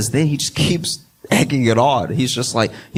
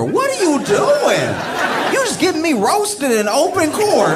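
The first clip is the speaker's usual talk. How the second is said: mock security guard; slower than usual. Security guard yelling at the lawyer in court, high and laughing. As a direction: play the guard blowing up at the lawyer. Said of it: "if I was a security guard".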